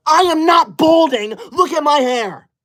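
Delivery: high-pitched, indignant shout; outraged denial. Acted. Streamer answering balding comment, outraged denial. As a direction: cry out in wounded protest.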